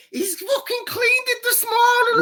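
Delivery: imitating the wife. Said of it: "I was like".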